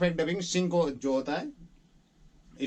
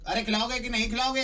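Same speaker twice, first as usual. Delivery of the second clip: acted line demo; 7 semitones higher than usual. Shown as a demonstration. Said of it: "इतना बता दो".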